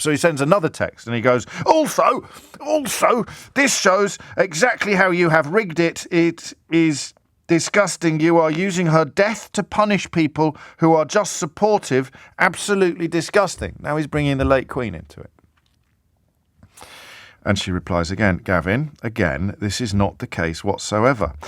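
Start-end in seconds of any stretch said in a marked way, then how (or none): 1.7-13.8 high, slow, indignant whine of an MP; mock outrage. Radio host reading an MP's furious texts, mock outrage. 18.4-21.3 calm reply. Retold or imitated. Host voices Wendy's calm reply.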